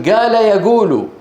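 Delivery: قال يقول said "gaala yaguulu"; qaf as g. Shown as a demonstration. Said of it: "ينطق الصوت نفسه مقابل".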